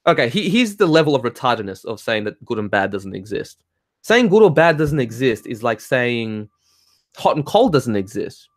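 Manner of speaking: usual speaking voice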